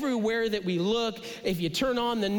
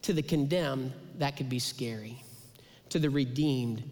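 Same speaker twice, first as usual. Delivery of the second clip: ordinary delivery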